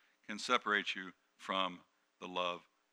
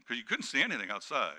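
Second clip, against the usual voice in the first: usual speaking voice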